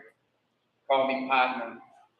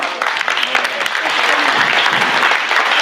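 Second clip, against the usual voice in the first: choked thanks; 11 semitones higher, 11 dB louder, much slower than usual. Real, not acted. Closing thanks, emotional.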